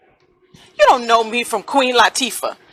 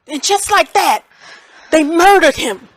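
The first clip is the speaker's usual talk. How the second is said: anguished outburst; 6 semitones higher, 6 dB louder than usual. Acted. Grieving mother breaks out.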